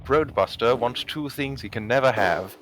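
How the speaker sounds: ordinary talk, nothing marked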